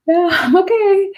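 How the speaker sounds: high, slow, forced reassurance; strained okay. Retold or imitated. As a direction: squeak it out like you're fine. Screaming in pain, reassuring waitress.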